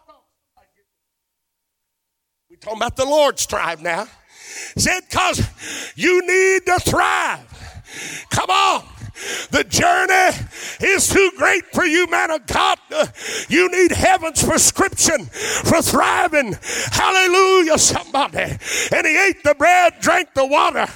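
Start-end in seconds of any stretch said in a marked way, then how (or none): 4.8-8.8 preaching shout. Acted. Preacher repeats refrain. 9.5-20.9 hammering gospel preaching; full-force preaching. Acted.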